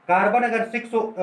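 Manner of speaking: usual speaking voice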